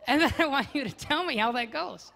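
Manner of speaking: teasing wife. Retold or imitated. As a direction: dare him, playfully. Continuing wife's teasing quote.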